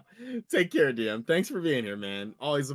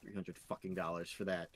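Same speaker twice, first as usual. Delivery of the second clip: quiet, bitter grumble under the breath; muttered; 6 semitones lower, 9 dB quieter than usual. Real, not acted. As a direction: mutter it low and sour. Bitter repeat after the rant.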